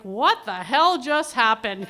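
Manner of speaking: hammed-up bewildered yell; imitated shocked dad. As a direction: play a stunned father exclaiming. Acts out dad's shocked reaction.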